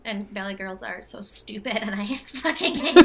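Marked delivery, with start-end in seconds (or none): 2.1-3.0 laughing through the words; cracking up mid-rant. Real, not acted.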